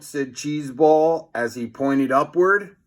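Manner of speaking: usual speaking voice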